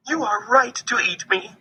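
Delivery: high, small squirrel voice; pleading squirrel. A narrator's reading. Squirrel pinned under Bear's paw, pleading. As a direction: plead like a trapped little squirrel. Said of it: "he said".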